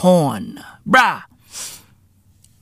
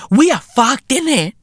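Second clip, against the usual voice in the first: normal talking voice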